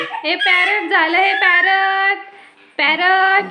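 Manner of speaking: high, slow, laughing sing-song. Real, not acted. Baby in cap, laughing. (टोपीतल्या बाळाला हसत गाण्यासारखे म्हणणे)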